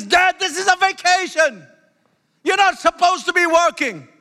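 high-pitched imitation of a scolding kid; protesting child. Child telling dad off on vacation, mock protesting.

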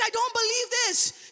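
high-pitched retold disbelief; imitated tearful disbelief. Imitating woman crying over her healed knee, tearful disbelief. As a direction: imitate her overwhelmed cry, pitched well up. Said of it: "she's crying and she's saying".

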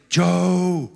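drawn-out, gentle summoning call; imitated calling. Preacher voicing God calling Joe by name, long gentle call. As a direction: call the name out long, without raising the volume. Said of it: "like calling".

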